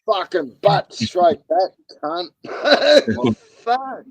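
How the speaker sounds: high, loud burst of excitement; excited outburst. Real, not acted. Mate reacting to a wild army story, excited outburst. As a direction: blurt it out, thrilled and shocked.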